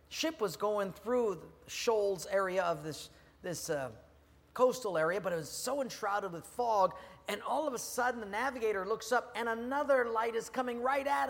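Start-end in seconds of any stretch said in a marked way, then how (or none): none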